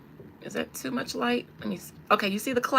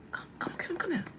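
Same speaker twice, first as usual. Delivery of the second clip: hushed, urgent beckoning; urgent whisper; 7 semitones higher, 10 dB quieter, faster than usual. Retold or imitated. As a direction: whisper to call him over. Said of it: "she was like".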